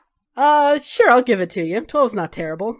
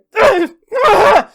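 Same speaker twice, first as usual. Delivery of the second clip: startled yelp; 14 semitones higher, 11 dB louder than usual. Acted. Sleeper startled awake.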